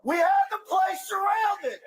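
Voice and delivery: shouted police commands. Real, not acted. Officer shouting at the suspect inside to come out, commanding yell.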